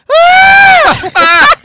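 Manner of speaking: very high yelp of pain, retold; mock scream. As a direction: let out a high pained howl like the knee snapped. Dannon screaming as knee blows.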